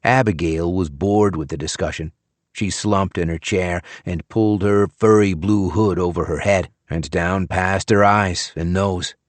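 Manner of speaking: usual speaking voice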